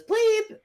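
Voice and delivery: high loud bleep sound; censor bleep. Acted. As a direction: imitate a TV censor beep.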